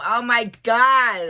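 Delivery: slow, loud, stretched Oh my God; loud drawn-out shocked exclaim; retold exclamation. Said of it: "and I go".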